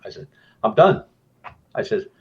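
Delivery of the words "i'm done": louder, higher, fed up; retold outburst. Investigator tells the team he is quitting, loud. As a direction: slam the door on it, I'm out. Repeating he quits.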